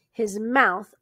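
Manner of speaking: ordinary delivery, nothing marked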